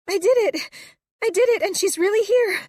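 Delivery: high, loud, overjoyed through tears; tearful joy. A narrator's reading. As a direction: cry out in triumph. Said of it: "tears pouring unchecked down my face".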